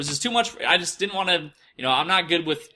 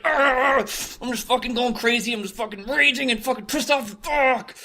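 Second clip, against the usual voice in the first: mock raging outburst; 9 semitones higher than usual. The man reenacting his raging forum posts, hammed-up fury. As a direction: imitate yourself losing it online. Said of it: "I would go on there and just be like".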